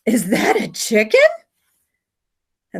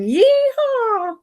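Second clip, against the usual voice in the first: yelling Yeehaw; 13 semitones higher, much slower than usual. Acted. Margot yelling up to Samson, high whooping call. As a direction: holler to your friend from the bottom. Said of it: "as she's yelling".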